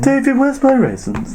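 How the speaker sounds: slow high-pitched robot; drawn-out high computer voice. Retold or imitated. High slow film quote.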